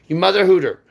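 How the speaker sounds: high, loud mock-censored curse; loud insult. Real, not acted. Ranting at men who abuse women, loud high insult.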